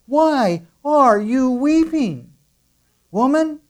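high, slow angel voice full of wonder; angels asking. Acted. Angels at the tomb asking weeping Mary, high and slow. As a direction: let the angels marvel at her tears.

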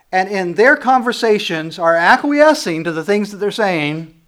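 full-force preaching. Acted. Pastor warns church about bad company, preaching full force. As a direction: drive the warning home to the flock.

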